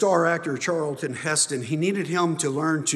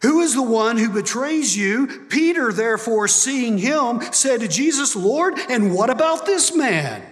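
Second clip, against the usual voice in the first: loud, raised preaching to the congregation; full-force preaching; 8 semitones higher, 6 dB louder than usual. Acted. Pastor preaching to the church, loud and driving. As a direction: preach it out with fire and conviction.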